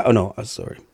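ordinary talk, nothing marked

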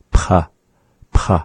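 "P" released with a raspy throat breath; breathy back P. Shown as a demonstration. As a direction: push breath from the back after each "P". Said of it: "breath with it from the back".

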